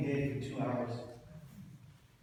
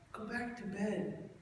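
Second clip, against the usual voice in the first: slow, softer mock dad settling back down; quieter, sleepy "Go back to bed"; sleepy dad trailing off; 9 semitones higher, 3 dB quieter, slower than usual. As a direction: drift off while saying it. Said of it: "He goes".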